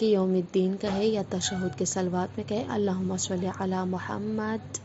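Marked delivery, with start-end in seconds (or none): 3.6-4.8 "علی محمد" slowly, final د of "محمد" stopped with no vowel; silent dal on Muhammad. Shown as a demonstration. Teacher showing students how to end "محمد" in prayer, slow stop on the final د. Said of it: "محمد کے دال کو ساکین پڑھے".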